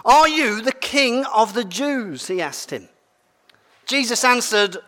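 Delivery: measured reading of the Gospel; lightly voiced Bible reading; scripture reading. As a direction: read it to the congregation, steady. Reads the Bible passage aloud.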